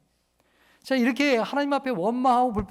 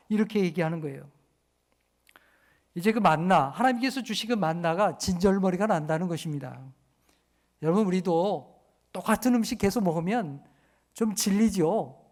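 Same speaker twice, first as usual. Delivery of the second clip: ordinary talk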